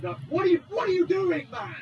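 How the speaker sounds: high, loud protest; exasperated shout. Real, not acted. Player to the driver in his way, exasperated shout. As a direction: yell at him in exasperation.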